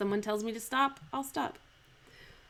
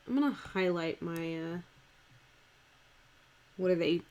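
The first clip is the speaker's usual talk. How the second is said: ordinary delivery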